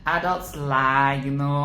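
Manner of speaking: normal talking voice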